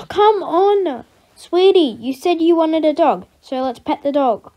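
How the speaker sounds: a fed-up parent urging the daughter to pet the dog; exasperated coaxing. Acted. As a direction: sigh and push her to pet it. Exasperated parent pushes child.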